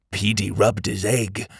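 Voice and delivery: ordinary delivery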